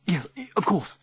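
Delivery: high, trembling Oxford gentleman; flustered posh Oxford voice; posh Oxford accent. A narrator's reading. Figgy answering the scary old lady, posh Oxford accent. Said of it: "in his posh Oxford accent".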